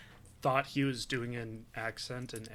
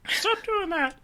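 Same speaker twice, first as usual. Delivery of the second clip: high, loud imitated dog yell; dad's dog voice; 6 dB louder, slower than usual. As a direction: squeal it as a scolding cartoon dog. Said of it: "has given my dogs voices".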